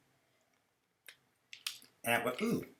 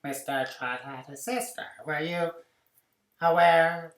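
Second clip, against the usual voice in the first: louder, slow, high clerk voice; mock prissy clerk; 6 semitones higher, 12 dB louder, slower than usual. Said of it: "he's like".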